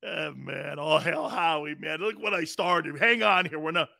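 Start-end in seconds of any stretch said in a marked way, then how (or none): none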